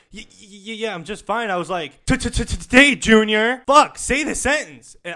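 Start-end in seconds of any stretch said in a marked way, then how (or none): 0.1-1.5 stuttering. Retold or imitated. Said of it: "he was like". 2.1-5.0 loud, slow, higher stammered yell; mock stuttering yell. Mocking cashier, yelling.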